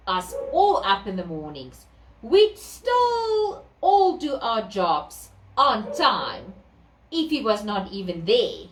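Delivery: usual speaking voice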